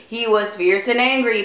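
ordinary delivery

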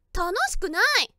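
loud sulky whine; loud pouty complaint. Acted. Complaining loudly to boyfriend.

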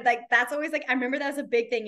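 usual speaking voice

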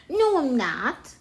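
loud, mildly sulky retort; scowling denial. A narrator's reading. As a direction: protest like a grumpy kid. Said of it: "Jim scowled".

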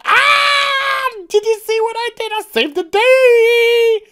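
very high, loud squealing brag; gushing excitement. Acted. Heroine bragging to her sister, excited shriek. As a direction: shriek it with glee, stretching the end.

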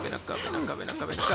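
high soft coaxing voice; sweet-talking a pet over; coaxing call. Acted. Coaxing a dog in game.

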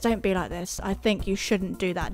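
low, soft reproach; hushed, hurt-sounding telling-off; quiet mock pleading. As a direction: scold gently under the breath.

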